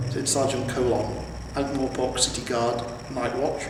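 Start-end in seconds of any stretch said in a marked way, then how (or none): none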